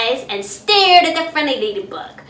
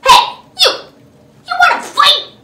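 much higher, loud squeaky challenge; grouchy challenge; 13 semitones higher, 8 dB louder than usual. Acted. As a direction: pick a fight in a tiny high voice. Grouchy ladybug challenges bumblebee.

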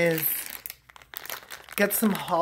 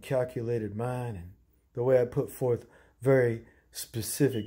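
low-voiced sketch character; 9 semitones lower than usual.

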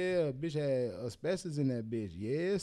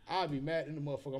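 ordinary talk, nothing marked

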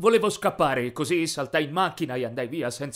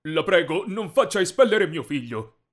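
loud, urgent begging; pleading; 4 semitones higher, 6 dB louder than usual. A narrator's reading. Father begging the rich boy for his son, urgent plea. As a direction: beg with raised voice and pitch. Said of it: "lui supplicò Jace".

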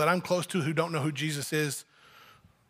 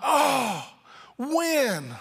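higher, drawn-out impatient pleading; groaning with longing; 4 semitones higher, slower than usual. Acted. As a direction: plead like a child tired of waiting. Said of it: "Paul groaning to be with Jesus".

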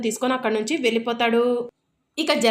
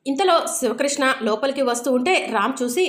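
plain reading voice

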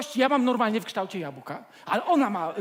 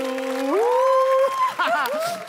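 high burst of laughter; 11 semitones higher, 6 dB louder than usual. Real, not acted. Awkward phone call ends.

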